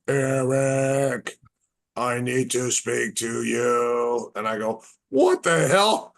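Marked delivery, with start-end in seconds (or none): 0.1-4.3 eerie TV voice. Retold or imitated. Storyteller voicing the TV spirit, slow eerie call. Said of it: "all of a sudden it goes". 5.1-6.1 much higher and louder, slow shocked cry; startled shout. Retold or imitated. Man startled by his TV speaking, shocked high shout.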